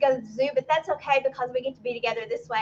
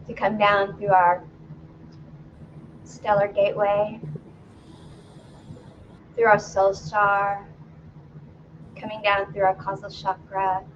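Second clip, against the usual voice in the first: calm, lower meditation voice with long pauses; guided meditation; 4 semitones lower than usual. Acted. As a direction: guide them gently inward, phrase by phrase. Leads a grounding meditation.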